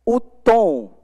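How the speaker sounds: ordinary delivery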